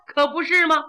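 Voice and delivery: ordinary delivery, nothing marked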